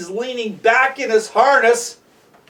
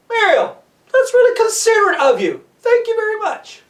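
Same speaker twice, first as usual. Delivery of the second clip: high-pitched, exaggerated thanks called upward; calling back up; 11 semitones higher than usual. Jonathan replying to Mario up top, calling back. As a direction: call it up high with showy gratitude.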